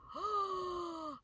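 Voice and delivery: high startled intake of breath; sharp gasp. Retold or imitated. Snapping out of the fear at home, startled gasp. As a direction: gasp as if waking from a trance. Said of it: "and I'm like".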